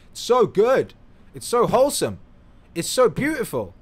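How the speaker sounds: high and loud, gushing praise. Real, not acted. Vlogger raving to viewers about a film, high and loud. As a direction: rave about the film with delight.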